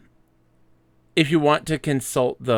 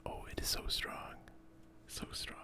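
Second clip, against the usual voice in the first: awed whisper; 23 dB quieter than usual. Real, not acted. Confessing to co-host a weakness for carbs, awed whisper. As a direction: whisper it as if in the grip of temptation.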